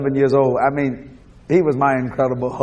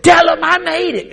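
high, loud retold call; hollering; 12 semitones higher, 6 dB louder than usual. Nephew showing his uncle how the aunt called, hollering. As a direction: imitate a holler from far off. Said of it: "She was hollering".

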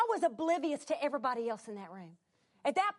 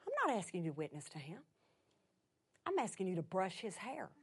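hushed, low, measured command; quiet, unhurried authority; God speaking inwardly; 9 semitones lower, 9 dB quieter than usual. Retold or imitated. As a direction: speak softly and firmly to the heart. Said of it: "the Lord spoke to my heart".

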